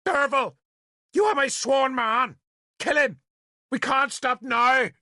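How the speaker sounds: high, urgent shouted demand; shouting. A narrator's reading. Nimue calls on her sworn man, shouting urgently. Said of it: "she shouted at me".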